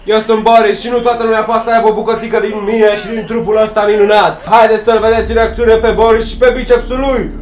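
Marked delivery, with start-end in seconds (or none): none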